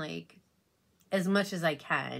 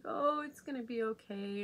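higher imitated comforting colleague; reassuring coworker; 7 semitones higher, 3 dB quieter than usual. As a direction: soothe her like the sweet coworker, lightly mimicked. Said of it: "And she's like".

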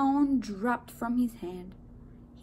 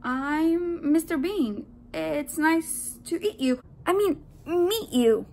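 raised, faltering introduction; stammering; 6 semitones higher, 6 dB louder, slower than usual. A narrator's reading. Man fumbles introducing himself to donut, mild stammer. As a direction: stumble through it, correcting the slip.

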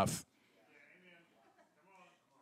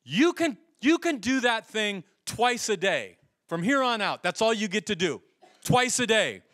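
retold stern limit; 7 semitones higher, 5 dB louder than usual. Friend rationing the scale ritual.

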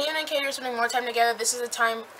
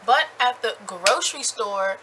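ordinary delivery

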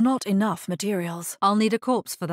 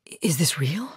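halting whisper, lower; shaken whisper; 3 semitones lower, faster than usual. Acted. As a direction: breathe the question in disbelief. Stunned at the blood petals.

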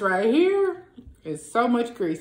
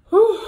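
loud whew; 13 semitones higher, 10 dB louder than usual. Real, not acted. Stuffed after eating eight pieces.

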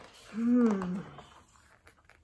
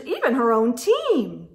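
ordinary delivery, nothing marked

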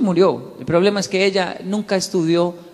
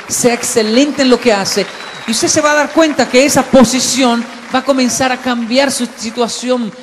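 much louder, higher, rousing preacher voice; preaching at full force; 6 semitones higher, 8 dB louder than usual. Real, not acted. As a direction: fire up the congregation. (predicación a todo pulmón)

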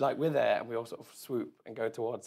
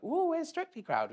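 high, drawn-out mock-posh question; sending up the glitzy London set; 5 dB louder than usual. Mimicking showbiz people in London, high and slow.

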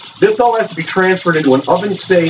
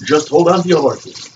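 mock French accent. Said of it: "I'm sick of the accent".

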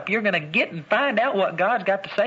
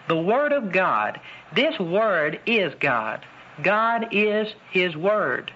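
slow, weighty; much slower than usual. Real, not acted. Slow emphatic preaching.

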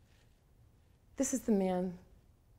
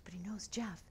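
hushed reply; 11 dB quieter than usual. Retold or imitated. Assistant answers in secret.

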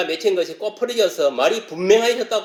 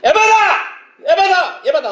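very high, loud cry; full commanding shout; 12 semitones higher, 4 dB louder, slower than usual. Shown as a demonstration. Preacher demonstrates crying out like Jesus, commanding shout. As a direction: shout it at full force, as an order. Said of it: "에바다를 외쳐야 돼".

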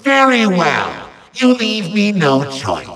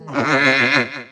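low, gloating villain snicker; evil chuckle; 6 semitones lower, 3 dB quieter than usual. Acted. Villain gloats over hero's flamey doom, low evil chuckle. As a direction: chuckle at your own threat.

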